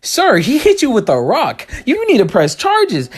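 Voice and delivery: high, slow mock-serious advice; mock concern. Mocking bearded teen.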